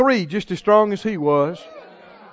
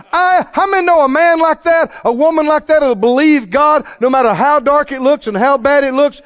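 fiery preaching; 5 semitones higher, 6 dB louder than usual. Acted. Preacher thunders scripture to the congregation. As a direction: proclaim it to the rafters.

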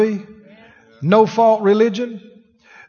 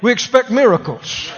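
preaching shout. Acted. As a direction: shout it out to the church. Preacher rallying congregation.

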